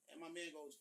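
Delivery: usual speaking voice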